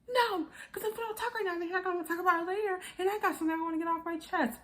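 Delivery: high, rapid mimicked protest; mock pestering. Partner insisting on talking right now, fast mock nagging. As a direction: rush it out high, refusing to let it drop.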